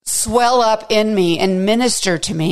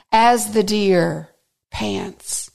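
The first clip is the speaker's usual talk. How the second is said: slow, fading; much slower than usual. Real, not acted. Slow reading of simile.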